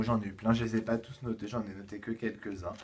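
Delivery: normal talking voice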